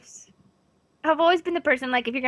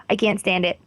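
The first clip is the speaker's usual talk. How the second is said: usual speaking voice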